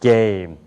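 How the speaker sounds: low "게임" with a throat-voiced g; American voiced "게임"; American-style game. Shown as a demonstration. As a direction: drop "게임" deep and glide through it. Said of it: "게임이 아니라 뭐예요".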